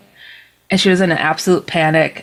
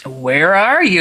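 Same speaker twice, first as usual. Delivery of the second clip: lightly mocking, humoring question; teasing skeptic; slower than usual. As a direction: ask as if playing along, not believing it. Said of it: "I'm kind of being a stinker".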